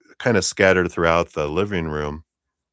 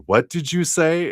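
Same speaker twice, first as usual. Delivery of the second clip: raised, higher confrontational challenge; mock outrage; 11 semitones higher, 6 dB louder than usual. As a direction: play someone provoked by an insult. Acting P confronting after an insult.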